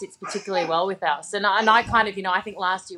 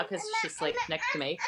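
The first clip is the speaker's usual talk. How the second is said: ordinary talk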